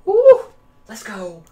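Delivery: loud gleeful cheer; excited whoop. Real, not acted. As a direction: gasp then a huge high whoop. Excited when she comes in.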